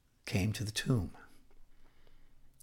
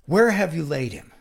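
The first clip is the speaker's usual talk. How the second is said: harsh, louder demand; 2 semitones higher, 6 dB louder than usual. Acted. Jesus, angry at death, asks where Lazarus lies, harsh. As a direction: demand it sternly, leaning on the first words. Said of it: "he said in a harsh tone".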